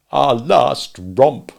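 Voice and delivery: slow, loud mournful groan. Acted. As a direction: lament the lost night. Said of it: "Mister Darling groaned".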